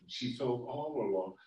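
plain reading voice

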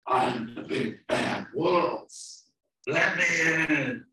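slow, loud, building wolf demand; big bad wolf. Wolf at the brick house, slow loud demand. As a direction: stretch the words and bellow the ending. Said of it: "And the wolf said".

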